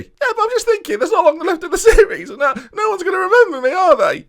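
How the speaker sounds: high cartoon voice with a laugh in it; squeaky player voice, laughing. Striker frets to manager he'll be forgotten, squeaky and giggly.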